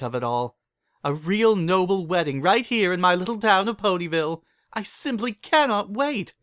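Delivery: very high, rising, breathless excitement; giddy squealing. Acted. Rarity gushes to Pokey about the wedding, giddy squealing. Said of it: "said Rarity in a giddy squeal".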